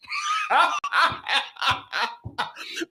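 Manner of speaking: real high-pitched burst of laughter; laughing. Real, not acted. Laughing at how parents apologize, real high laughter.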